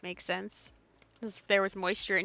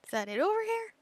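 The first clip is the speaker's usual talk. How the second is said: surprised question; 12 semitones higher, 3 dB louder than usual. Real, not acted. Spotting something in the game.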